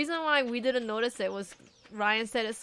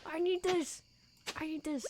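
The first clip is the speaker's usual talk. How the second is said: quiet mock desperation; 5 semitones higher, 8 dB quieter than usual. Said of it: "that kind of desperation".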